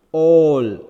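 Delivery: all, loud, with the vowel held; long O in all. Shown as a demonstration. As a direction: stretch and stress the aw of all. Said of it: "So, A is stressed".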